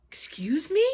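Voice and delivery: gasped, breathy whisper of disbelief; amazed whisper of disbelief at the babysitter; astonished gasp. Acted. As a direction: gasp it out, stunned. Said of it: "gasped an astonished, astounded, amazed".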